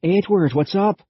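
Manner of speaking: alarmed call. A narrator's reading. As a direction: shout his name to reach him. Calling out to running boy.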